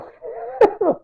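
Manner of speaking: high giggle; short high-pitched chuckle; laugh. Real, not acted. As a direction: laugh high at your own mess. Laughs at his messy writing.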